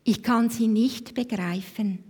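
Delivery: ordinary delivery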